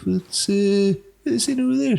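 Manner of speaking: high, curious voice, pretending to be casual; feigned innocent interest. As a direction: sneak in the question sweetly.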